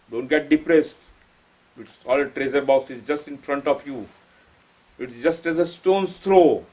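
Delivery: ordinary talk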